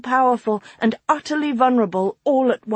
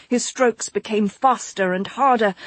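usual speaking voice

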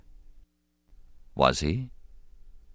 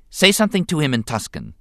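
put-on sharp, higher boyish command; ordering; 10 semitones higher than usual. A narrator's reading. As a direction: boss the droid around. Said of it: "he ordered C three P O finally".

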